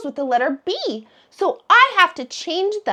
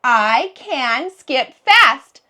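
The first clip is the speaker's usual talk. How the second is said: words read out slowly and evenly; slow model sentence; 3 semitones lower, 3 dB louder, much slower than usual. Shown as a demonstration. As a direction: read each word slowly for the kids. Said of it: "My sentence is".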